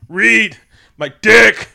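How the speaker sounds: high, loud, slow, laughing scream; a fan recalls the rock monster screaming, laughing yell; mock screaming. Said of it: "this man screaming".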